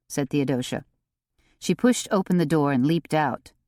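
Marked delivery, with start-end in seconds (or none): none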